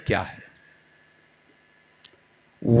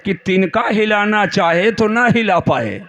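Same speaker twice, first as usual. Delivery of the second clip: rhetorical build to a ringing finish; fervent preaching; 3 semitones higher than usual. Real, not acted. Preacher contrasts follower and Prophet for the congregation, rising oratory. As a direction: pose the contrast, then drive it home to the crowd.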